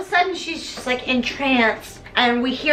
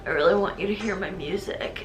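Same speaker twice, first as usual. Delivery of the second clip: Chad; 6 semitones lower, 6 dB quieter than usual. Acted.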